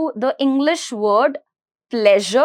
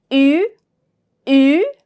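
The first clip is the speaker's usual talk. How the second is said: ordinary delivery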